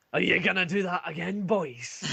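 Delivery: a lightly voiced officer catching them out; scolding commander.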